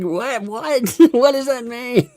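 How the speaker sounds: high-pitched, laughing bafflement; baffled disbelief. Acted. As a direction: react in incredulous, amused confusion. Baffled reaction to absurd tweets.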